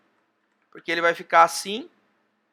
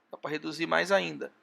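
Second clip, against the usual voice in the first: softer echo of the same phrase, said to himself; quiet repeat; 2 semitones lower, 7 dB quieter than usual. Real, not acted. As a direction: repeat it under the breath. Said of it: "mas dá pra reduzir mais ainda". (repetição mais baixa, quase para si mesmo)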